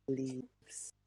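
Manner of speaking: normal talking voice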